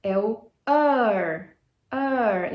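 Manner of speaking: slow er with a curled r; drawn-out er. Shown as a demonstration. Teacher showing the vowel with curled r. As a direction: stretch the er. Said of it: "Rzinho puxado".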